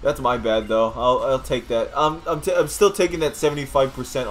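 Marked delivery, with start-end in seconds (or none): none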